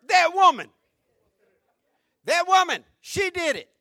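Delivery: loud, high and pointed, "woman" falling hard; emphatic Adam. Retold or imitated. Adam blaming the woman before God, loud and pointed. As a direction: hurl the blame onto "woman". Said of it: "First two words".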